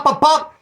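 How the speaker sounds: high loud pop pop; retelling the shooting with mock gunfire; gunshot pops. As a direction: imitate gunshots. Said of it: "shooting at us".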